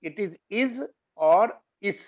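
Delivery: it is is, with the last is slowed and ending in a soft s sound; contrasting is with iz and is. Shown as a demonstration. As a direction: say it is is, then इस with a clear s. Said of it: "लेकिन इज नहीं है ये शब्द".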